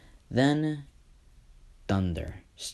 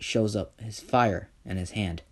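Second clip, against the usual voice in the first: plain reading voice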